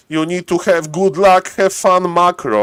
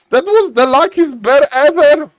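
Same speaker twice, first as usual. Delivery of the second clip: amazed exclaim; 9 semitones higher, 4 dB louder than usual. Real, not acted. Caster amazed.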